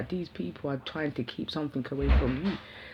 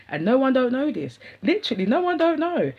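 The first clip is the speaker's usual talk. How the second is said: much higher and louder, bursting to tell; excited outburst; 8 semitones higher, 7 dB louder than usual. Real, not acted. Telling viewers the secret she's kept, excited and loud. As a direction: blurt it out, thrilled at the secret.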